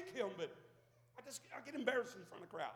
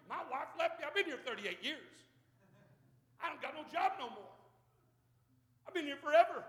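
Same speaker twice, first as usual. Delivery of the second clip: higher, louder whining lament; self-pitying lame man; 5 semitones higher, 5 dB louder than usual. Acted. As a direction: wallow in it, poor me. Lame man voiced, self-pity.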